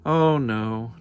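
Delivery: plain reading voice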